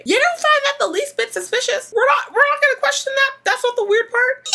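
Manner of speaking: incredulous. Real, not acted. Ranting about the scam.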